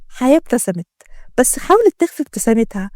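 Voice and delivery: ordinary delivery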